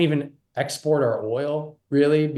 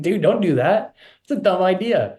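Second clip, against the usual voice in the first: exasperated plea; 8 semitones higher, 4 dB louder than usual. Retold or imitated. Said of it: "I'm just like".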